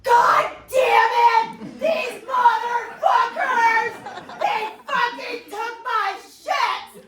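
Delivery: high, slow, loud screaming rant; imitating a passenger yelling on the phone about her seized bottles; furious phone rant. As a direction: scream it out in outrage. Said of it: "she's like".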